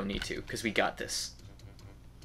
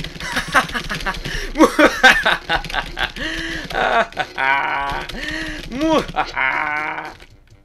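long high maniacal cackle; evil cackle; 8 semitones higher, 7 dB louder than usual. Pretend. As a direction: cackle like a mad villain. Evil laugh after gloat.

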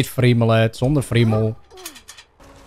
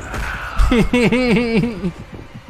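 high giggle dying away; high, softening laughter; helpless giggle; 8 semitones higher than usual. Real, not acted. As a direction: giggle helplessly in quick bursts. Laughs at own bouncing.

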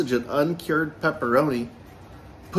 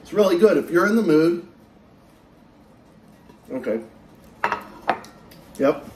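talking between bites of food; chewing pizza while talking. Real, not acted. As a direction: mouth partly full of pizza. Seen 2: Holds slice near face; mouth seems busy between bites throughout.

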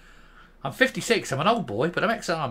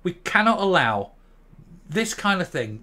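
ordinary talk

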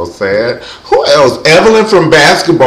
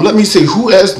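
usual speaking voice

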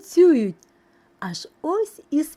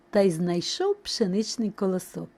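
ordinary delivery, nothing marked

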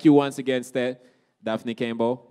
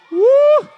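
hype cry; 4 dB louder than usual. Real, not acted. MC hyping crowd for music.

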